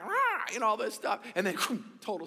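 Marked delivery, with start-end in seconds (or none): 0.0-0.5 a sharp high squawk; parrot squawk. Retold or imitated. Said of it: "he heard this squawking".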